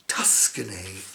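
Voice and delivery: breathy whispered aside. Real, not acted. Parent whispering a pun aside while reading. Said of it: "whispered joke aside".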